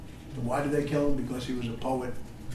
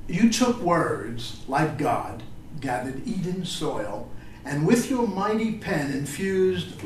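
slow, measured poetry recital; solemn tribute poem read aloud; slower than usual. Acted. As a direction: let each image land slowly. Reads his poem to Vallejo.

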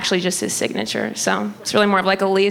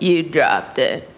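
low, slow store-clerk impression; put-on deeper clerk voice; store staff; 5 semitones lower, slower than usual. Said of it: "they were like".

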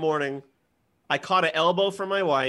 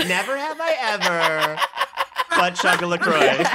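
put-on teen girl voice; 3 dB louder than usual. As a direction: play a giggly seventh grader. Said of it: "seventh grade girls at a sleepover".

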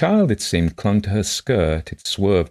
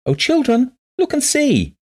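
very high, loud, singing call; 6 dB louder than usual. A narrator's reading. Blind woman calling shy children over her shoulder, high cry. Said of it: "and cried again".

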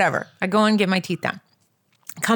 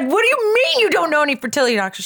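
fast, higher-pitched disbelieving challenge; indignant; 6 semitones higher, faster than usual. Retold or imitated. Patient challenges the woman who denies knowing doctors, indignant. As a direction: retell your outraged comeback. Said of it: "I was so mad".

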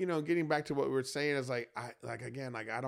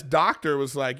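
normal talking voice

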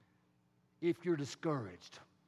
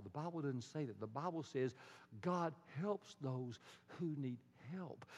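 usual speaking voice

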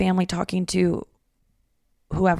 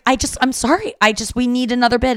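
flustered mom; 5 semitones higher, 5 dB louder than usual. Retold or imitated. Mom voiced in retold argument.

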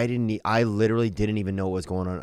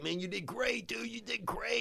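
much higher and softer, gently encouraging; soft, high stoned praise; 14 semitones higher, 11 dB quieter than usual. Retold or imitated. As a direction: reassure the comic like a kindly, spaced-out organizer. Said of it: "the guy there was like".

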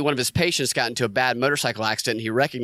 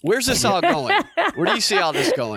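high, loud laughing question; 11 semitones higher, 3 dB louder than usual. Real, not acted. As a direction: ask it while cracking up. Laughing question after rant.

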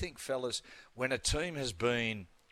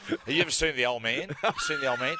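laughing; 5 semitones higher, 4 dB louder than usual. Real, not acted. Teasing a co-host about hair.

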